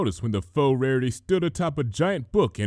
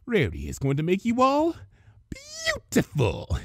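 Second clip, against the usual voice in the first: creepy promise; 8 semitones higher than usual. Acted. Villain threatens makeover.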